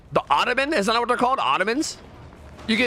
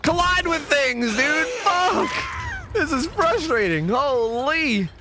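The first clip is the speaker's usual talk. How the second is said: fed-up yell at the glitchy map; frustrated yell; 12 semitones higher, much slower than usual. Real, not acted. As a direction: shout at the game in real frustration. Frustrated yelling at game.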